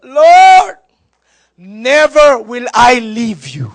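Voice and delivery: loud, high cry of Lord then slow vow; dramatic vow. Acted. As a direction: overplay Peter's big promise. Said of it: "ito pa ang drama". (madramang pangako ni Pedro)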